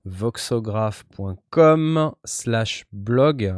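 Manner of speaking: very slow, each word apart; slow and spelled out. Shown as a demonstration. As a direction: read it out slowly. Repeats the address slowly.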